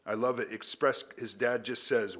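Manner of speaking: ordinary delivery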